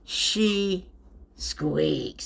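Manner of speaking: ordinary delivery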